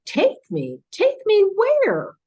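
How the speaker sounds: a hammed-up challenge flung back at the captor; boisterous retort. Retelling a defiant reply to the alien, hammed-up loud. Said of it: "I got a little bit boisterous".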